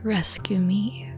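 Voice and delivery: ordinary delivery, nothing marked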